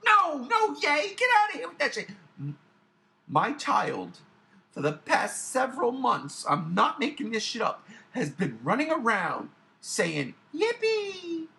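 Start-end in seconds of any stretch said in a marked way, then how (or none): 0.1-2.1 loud, high shouted dismissal; indignant rejection. Real, not acted. Fan rejecting the hosts' fake cheer, exasperated shouting. 10.6-11.5 put-on high, drawn-out childish cheer; baby-talk squeal. Parent mimicking their child running around, high baby talk. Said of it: "baby talk like that".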